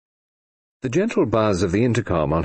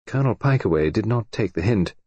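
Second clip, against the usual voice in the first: plain reading voice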